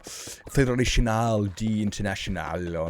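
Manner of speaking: "Fédération" said the French way, with the stress at the end; French pronunciation. Shown as a demonstration. Streamer shows chat the French name of FIFA, in a half-hearted French accent. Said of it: "it's pronounced".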